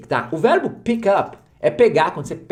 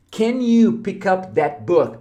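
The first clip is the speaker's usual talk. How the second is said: very slow, careful English question; slow model question; 3 semitones higher, much slower than usual. Shown as a demonstration. Teacher models the question slowly for students. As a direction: say it slowly so learners catch every word. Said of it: "essa é uma pergunta".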